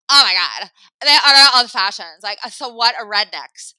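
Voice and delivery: loud, snooty mock voice; mocking the woman. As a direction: ham up a loud, sneering woman. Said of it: "I hear her say".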